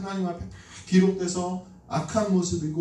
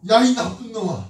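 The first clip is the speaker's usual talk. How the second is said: raised, louder telling-off; retold scolding; 4 semitones higher, 5 dB louder than usual. Preacher replays telling off the child who swapped money, scolding. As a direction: scold sharply, replaying a parent's outburst. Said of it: "막 야단쳤어요".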